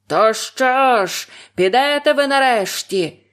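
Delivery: slow, loud raging shout; furious yell. A narrator's reading. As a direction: roar up from below. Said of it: "розлючено загорлав знизу".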